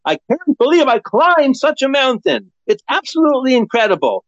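lightly acted tearful amazement; mock tearful awe. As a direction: marvel at the mountain you climbed, half-crying. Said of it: "They're crying and saying".

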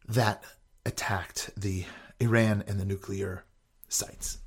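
soft unvoiced whisper. Real, not acted. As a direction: drop to a secretive whisper. Hushed aside about nuclear strike.

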